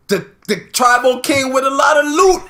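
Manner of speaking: high, loud joking holler; hyped-up shout. Acted. Host hollers a joke to cohost, hyped-up shout. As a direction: holler it high and big for laughs. Hyping up a joke phrase, then laughter.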